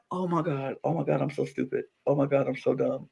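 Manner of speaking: soft, fast muttered self-scolding; mock self-blame. Imitating the player blaming himself, soft quick mutter. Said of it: "And he was like".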